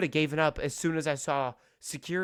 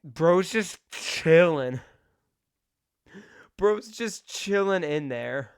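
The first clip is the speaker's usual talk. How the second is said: amused disbelief; 3 semitones higher, 5 dB louder, much slower than usual. Real, not acted. Laughing at guard on stream.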